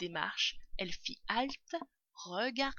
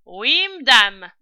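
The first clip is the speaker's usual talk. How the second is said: slow, loud, raised reply; calling up; 4 semitones higher, 19 dB louder, slower than usual. A narrator's reading. Servant accepting the poisoning order, calling up. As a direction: draw out the answer, hollered upstairs.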